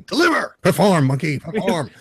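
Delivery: high, hammed-up orders; playful ringmaster demanding a show; mock commanding. Co-host teasing the host under pressure, mock command.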